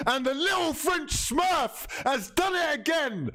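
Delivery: high, jubilant yell; excited shout. Real, not acted. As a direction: roar it out in delight. Goal celebration.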